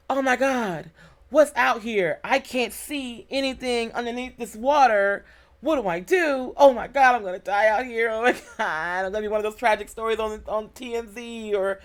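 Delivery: high, mock-panicked inner monologue; retold panic. Woman stranded at sea imagining sharks beneath her, retold panic. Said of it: "all the bad stuff starts coming in your head".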